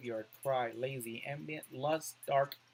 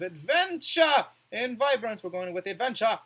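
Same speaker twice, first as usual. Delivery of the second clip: excited; 6 semitones higher, 8 dB louder than usual. Real, not acted. Excited pick.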